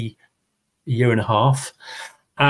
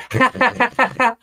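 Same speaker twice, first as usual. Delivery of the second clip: high rabbit giggle; 10 semitones higher than usual. Acted. Rabbit laughing.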